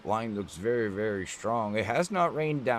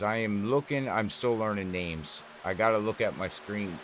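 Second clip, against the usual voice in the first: usual speaking voice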